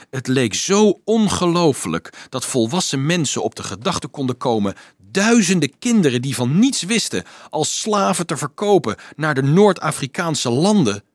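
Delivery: ordinary delivery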